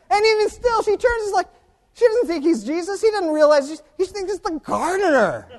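high, loud, worked-up storytelling; impassioned preaching. Real, not acted. Preacher telling how the Lord had to detour, impassioned. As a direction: preach it at full pitch, incredulous.

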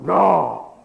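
loud, mock-indignant no; emphatic denial. Peter denying to the little girl, loud and emphatic. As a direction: burst out with a flat refusal.

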